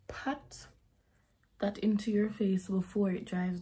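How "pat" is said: whispered word. Real, not acted. Soft instruction while applying.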